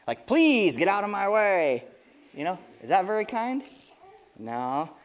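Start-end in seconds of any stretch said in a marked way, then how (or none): 0.3-1.8 rude, drawn-out "please", pitch arching down; unkind please. Shown as a demonstration. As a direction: say please like a pushy demand. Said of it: "but it's not very kind".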